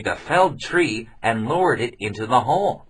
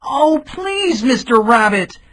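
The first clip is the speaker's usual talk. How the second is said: high, loud desperate plea; pleading cry; 6 dB louder, slower than usual. Acted. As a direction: beg the rabbit for help. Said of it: "cried the traveler".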